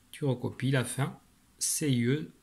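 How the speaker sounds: ordinary talk, nothing marked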